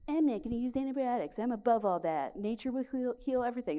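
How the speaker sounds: mock high smug voice, above it all; a hint of self-righteous hippie; smug past self. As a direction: parody your naive know-it-all self. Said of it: "I was like".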